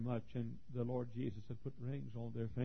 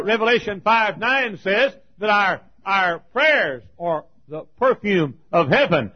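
loud and high, rushing, then a ringing question; preaching at full force; 8 semitones higher, 18 dB louder, slower than usual. Acted. Preacher to his congregation, asking about the ringed men, preaching loudly. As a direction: cry it out over the crowd. Quotes Revelation from the pulpit.

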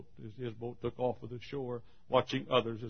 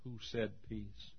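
hushed sentinel; 10 dB quieter than usual. Acted. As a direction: ask it quietly, under the noise. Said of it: "a sentinel on guard said".

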